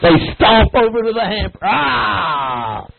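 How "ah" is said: put-on low, growled grunt of a stomping kid; Neanderthal grunt. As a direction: grunt like a caveman. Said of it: "It's like some Neanderthal".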